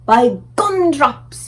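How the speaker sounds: high, slow giant exclamation; BFG crying out. A narrator's reading. The BFG crying out in delight at the ballroom. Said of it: "he cried".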